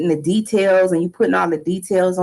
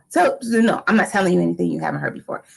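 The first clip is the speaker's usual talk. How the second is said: ordinary delivery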